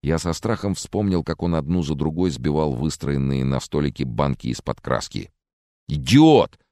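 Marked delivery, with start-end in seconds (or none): 5.9-6.6 loud, higher angry bark; barking angrily. Acted. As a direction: snap at him like a command. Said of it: "рявкнул Генри". (резкий злой окрик, громко)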